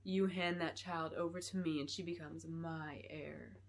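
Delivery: low sinister villain. A narrator's reading. Clarice's sinister demand.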